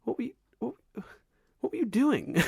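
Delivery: high, halting, baffled questioning; baffled reaction. Retold or imitated. Viewer baffled by a film scene, high stammering disbelief.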